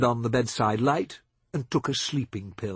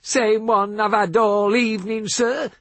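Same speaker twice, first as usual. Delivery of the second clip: higher, louder drawled American South; lazy southern drawl; 9 semitones higher, 6 dB louder than usual. A narrator's reading. Billy Joe answering Seth in his lazy Southern drawl. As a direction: let the Southern words stretch out easy. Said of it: "in that lazy southern drawl".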